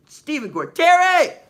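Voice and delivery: loud, drawn-out roll-call shout. Real, not acted. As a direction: belt the name out high and long. Calling out names in roll call.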